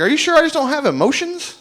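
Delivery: high-pitched incredulous patient; high, baffled protest. Retold or imitated. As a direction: question it in rising disbelief. Patient questions a hasty diagnosis.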